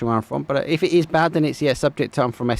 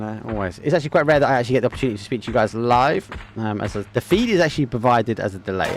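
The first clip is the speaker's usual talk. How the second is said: ordinary delivery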